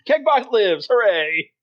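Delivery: high-pitched mock-heroic hurrah; triumphant cheer. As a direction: cheer the comeback as the session closes. Game master cheers the robot's return.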